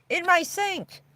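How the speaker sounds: delighted squeal. Real, not acted. Showing off her new van.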